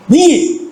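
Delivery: a marvelling hit on వెయ్యి; stress on వెయ్యి. Real, not acted. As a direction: punch out వెయ్యి as if it can't be true. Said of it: "stressed figure".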